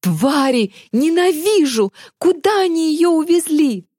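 high, hateful wail; anguished whining. A narrator's reading. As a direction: whine in helpless rage. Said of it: "сжал кулаки и заскулил". (скулить от бессильной злости)

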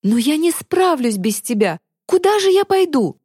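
high, helpless plea; desperate pleading. A narrator's reading. Girl begging her friend not to leave, desperate pleading. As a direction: plead with pitch climbing sharply.

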